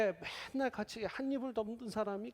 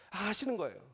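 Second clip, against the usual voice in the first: usual speaking voice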